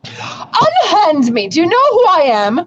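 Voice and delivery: high, slow, grandly indignant; hammy stage actor. Acted. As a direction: overplay the outraged noble. Captured noble protests dramatically.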